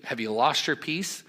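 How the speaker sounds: ordinary delivery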